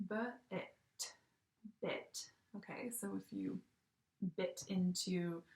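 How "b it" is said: segmenting b-it into sounds; sounded out. Shown as a demonstration. Teacher sounding out a word for her class. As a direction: stretch b-it apart, sound by sound. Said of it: "it says".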